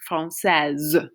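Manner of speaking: française ending in a held Z; final Z sound. Shown as a demonstration. Teacher illustrates the rule, française with Z ending. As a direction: make the S of française buzz like Z. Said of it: "sounds like a Z".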